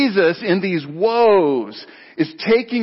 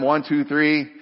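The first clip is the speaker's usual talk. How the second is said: ordinary talk, nothing marked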